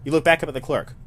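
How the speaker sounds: ordinary talk, nothing marked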